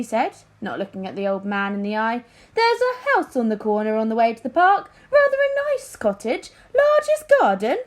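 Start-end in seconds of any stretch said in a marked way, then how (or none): 2.5-7.8 the king's voice, much higher and louder; a vain king, pitched up high and casually probing; pitched-up, pompous king voice; King Fred. A narrator's reading.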